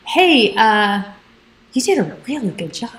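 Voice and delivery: lightly imitated radio host voice; a hint of a radio countdown host; Casey Kasem. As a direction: put on a touch of the announcer. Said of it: "and in his voice".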